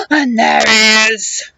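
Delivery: low giant roar. Acted. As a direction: bellow like a giant. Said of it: "the giant roared".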